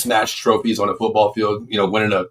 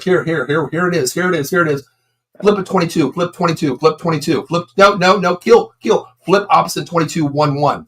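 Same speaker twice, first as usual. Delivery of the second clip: imitated quarterback cadence, higher and urgent; quarterback calling audible; 7 semitones higher than usual. Son at the line changing the play, imitated higher-pitched audible call. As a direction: rattle out repeated play calls in a raised pitch. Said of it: "he calls an audible".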